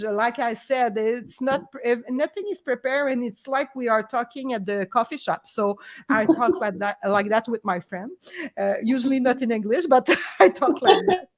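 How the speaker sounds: ordinary talk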